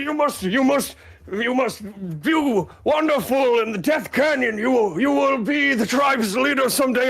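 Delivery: hammed-up wailing farewell, very high; tearful guardsman. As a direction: wail like an overwrought character saying goodbye. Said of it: "tears streaming from his eyes".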